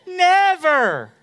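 put-on half-laughed toddler protest; child whine. Son begging for the phone, mock whine. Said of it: "I never get to play".

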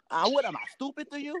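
a hint of an angry Italian-American, rising high on stupid; lightly hammed-up indignant New York Italian; playful take on an Italian who asks angry questions; mock Italian anger. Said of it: "they just ask angry questions".

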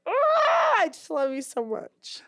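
high squealing love-struck coo; squealed baby talk to a dog; gushing baby talk. Shown as a demonstration. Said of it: "I'm just like".

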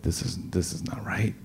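hushed unvoiced whisper of dawning dread; frightened whisper to himself; whispered alarm. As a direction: breathe it out, barely a whisper. Alarmed realizing driver is unconscious.

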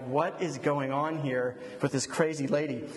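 ordinary talk

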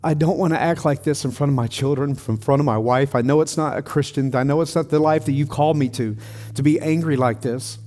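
a broken man begging God to take his anger; pleading prayer. Retold or imitated. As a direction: cry out to God as if back on your knees. Retold prayer on his knees.

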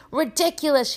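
high, contained shout; Neville. A narrator's reading. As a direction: cry the spell out high. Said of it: "he shouted".

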